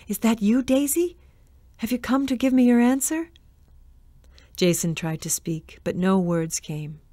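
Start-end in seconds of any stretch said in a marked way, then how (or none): 0.1-3.3 eager ghostly call. A narrator's reading. Ghost calling for lost love.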